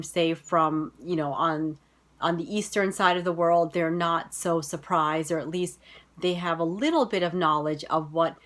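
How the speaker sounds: usual speaking voice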